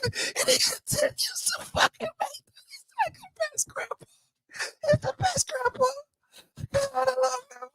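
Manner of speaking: high, breathless whisper through fake tears; hammed-up tearful whisper; mock whisper. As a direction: whisper it as if choked up. Fake-sob fades to whisper.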